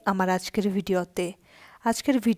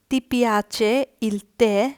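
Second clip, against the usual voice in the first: slow teaching read; 3 dB louder, much slower than usual. Shown as a demonstration. Italian teacher reading the question slowly to Bengali students. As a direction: spell out Ti piace il caffè? word by word for learners. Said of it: "পরের প্রশ্নটি".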